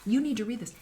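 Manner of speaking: ordinary talk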